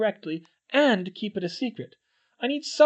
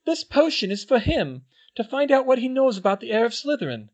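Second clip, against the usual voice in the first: high, loud, fast frightened voice; on the edge of tears; scared girl; 11 semitones higher, 7 dB louder than usual. A narrator's reading. Hermione confides her fear to the twins, high and scared.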